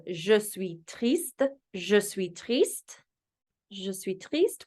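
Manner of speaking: Je suis triste sounded out as zhuh swee treest; slow French. Shown as a demonstration. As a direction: drill Je suis triste slowly. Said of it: "We also have".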